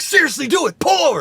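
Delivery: high, loud insistence; insistent yell. Retold or imitated. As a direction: demand it, no arguing. Insisting loudly to his friend.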